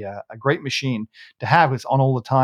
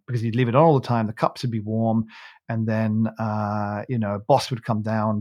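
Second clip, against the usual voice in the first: ordinary talk, nothing marked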